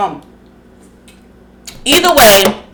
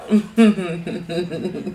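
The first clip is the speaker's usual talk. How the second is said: soft laugh; 3 semitones lower, 7 dB quieter than usual. Real, not acted. Laughing at gossip.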